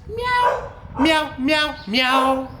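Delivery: high meow; meowing cat. Acted. Animal song, cat sound.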